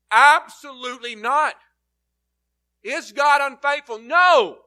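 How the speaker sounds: emphatic no. Shown as a demonstration. Preacher booming Paul's emphatic no. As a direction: hammer the no home. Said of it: "that's the emotion".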